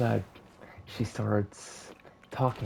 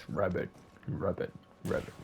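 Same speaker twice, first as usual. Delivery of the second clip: hushed croaking ribbit; frog croak; 5 dB quieter than usual. Acted. Frog calls out from the lake in a hushed croak. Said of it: "she hears".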